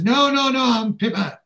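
very high, loud mock protest; alarmed protest. Pippa fending off Neville's mistaken kiss, high alarmed protest. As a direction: fend off a mistake in an imitated high voice.

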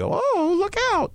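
squeaky high cartoon yelp, way up. Shown as a demonstration. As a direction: jump way up into a cartoon voice. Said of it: "I do, but I do".

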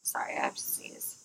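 hushed apology before a sneeze; whispering. Real, not acted. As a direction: whisper it, holding back a sneeze.

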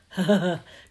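loud warm chuckle; hearty chuckle. Acted. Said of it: "The giant chuckled".

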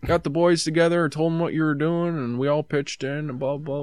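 breezy retelling of the brother; casual upbeat quote; friendly brother. Retold or imitated. Brother's speech retold.

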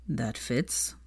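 murmured. A narrator's reading. Draco teasing Hermione quietly, murmured aside. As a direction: toss it off under your breath. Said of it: "murmured Draco".

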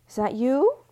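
loud high call. A narrator's reading. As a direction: call her name into the room. Calling out in the dark.

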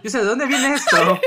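stunned outrage. Retold or imitated. Retelling his reaction to the ex's breakup message, loud and shocked. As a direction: blurt it out in shocked disbelief.

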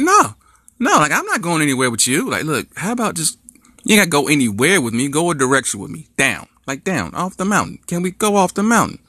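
high frantic back-and-forth; pleading on the cliff. Acted. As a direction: argue it high and panicky. Girlfriend refuses, boyfriend pleads.

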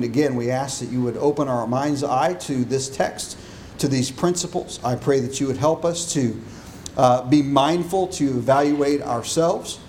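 hushed pastoral prayer. Acted. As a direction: pray quietly before the congregation. Preacher leads opening prayer.

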